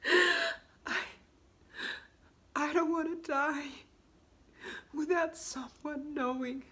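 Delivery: high, loud, gasping plea through tears; tearful breakdown. Acted. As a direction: break down crying, fighting for breath between phrases. Monologue breaks down over fear of dying.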